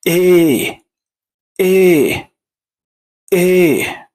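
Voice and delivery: drawn-out "إيه", a long falling "ey"; second E sound. Shown as a demonstration. Teacher reviews the second pronunciation, long held "إيه". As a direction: lengthen the vowel of "إيه". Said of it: "النطق التاني".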